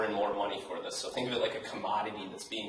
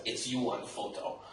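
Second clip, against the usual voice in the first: light mock Russian accent, stress on "you"; 5 dB quieter than usual. Shown as a demonstration. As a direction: say "It's you on photo." like a Russian spammer. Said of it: "think of this like with a Russian accent".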